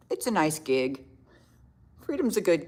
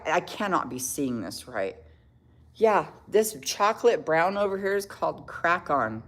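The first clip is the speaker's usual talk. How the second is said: ordinary talk, nothing marked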